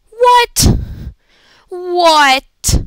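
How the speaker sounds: high, loud, incredulous outburst; disbelieving yell. Real, not acted. Gamer reacting to a shocking play, disbelieving yell.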